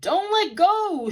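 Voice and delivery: slow, high, loud desperate shout. Acted. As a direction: bellow it across the tent. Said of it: "shouted the ringmaster".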